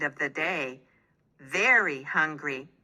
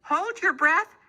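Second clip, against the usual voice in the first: helpful baker; 10 semitones higher than usual. A narrator's reading. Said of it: "said Homer".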